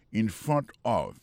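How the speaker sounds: slow, carefully spaced words; deliberate classroom pace; slow teaching read. Shown as a demonstration. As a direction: spell it out slowly for learners. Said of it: "Jodi a mwen kampe".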